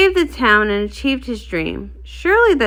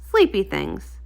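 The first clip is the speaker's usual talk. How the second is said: usual speaking voice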